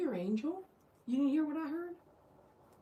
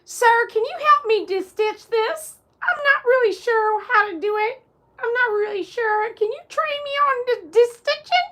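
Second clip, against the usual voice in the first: mock polite plea; 11 semitones higher, 11 dB louder than usual. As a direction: plead politely in a silly high voice. Character asks sir for training.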